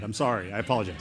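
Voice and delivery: ordinary talk, nothing marked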